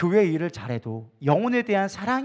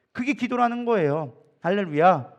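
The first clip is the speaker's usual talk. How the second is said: usual speaking voice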